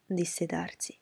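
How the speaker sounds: ordinary delivery, nothing marked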